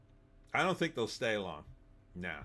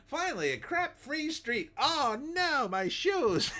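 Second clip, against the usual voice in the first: much higher, louder squeal of dismay; mock dismayed emigrant; 4 dB louder, slower than usual. Newcomer to Toronto stepping in crap, squealing. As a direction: shriek like you just stepped in it.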